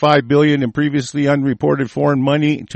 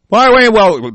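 higher and louder, a sudden objection; startled objection; 6 semitones higher, 4 dB louder than usual. Real, not acted. Objecting to a report he is reading, startled. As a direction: stop short at what he just read.

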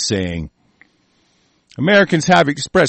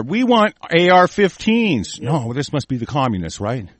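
normal talking voice

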